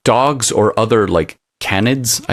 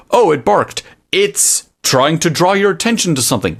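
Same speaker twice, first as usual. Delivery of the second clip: higher, sarcastic know-it-all reading; lightly hammed-up translator voice; mock dog expert; 10 semitones higher than usual. Mocking the AI dog translator, higher sarcastic voice. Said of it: "You might be able to".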